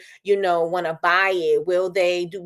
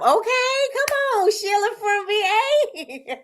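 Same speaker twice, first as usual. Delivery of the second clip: very high, squealing greeting, slow on the name; high, laughing shout-out to a fan; excited shout-out; 11 semitones higher, slower than usual. Real, not acted. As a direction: greet a viewer with delight.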